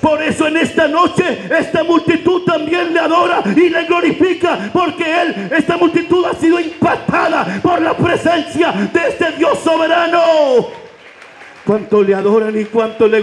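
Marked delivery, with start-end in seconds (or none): 0.0-10.2 high, ringing preacher's shout; preaching at full force. Acted. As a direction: lift the congregation up. Preacher exalting the crowd. (predicación a todo pulmón)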